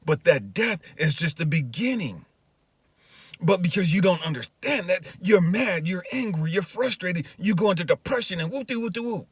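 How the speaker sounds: high, loud preaching rebuke; God's forceful charge, preached out; impassioned exhortation. Retold or imitated. God's exhortation preached at full pitch.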